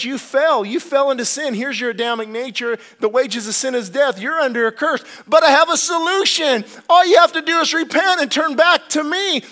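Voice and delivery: high, loud preached voice of God; preaching God's call. Retold or imitated. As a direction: proclaim it as God calling sinners back.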